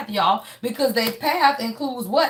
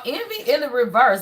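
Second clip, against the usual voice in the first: ordinary talk, nothing marked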